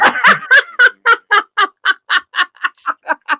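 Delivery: high, loud burst of laughter; big laugh. Real, not acted. Coach laughing at his own kid-sugaring joke, big laugh. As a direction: let a big laugh break out, falling.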